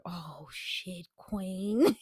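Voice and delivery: breathless hushed swear; shocked whisper. Retold or imitated. Nanny realizing the boss's plans, whispering in shock. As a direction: whisper it in disbelief. Reacting to the party reveal.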